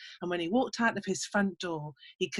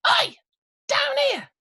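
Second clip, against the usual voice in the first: very high, loud squeaky shout; little man calling up; 5 dB louder than usual. Acted. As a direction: yell up from below, annoyed and tiny.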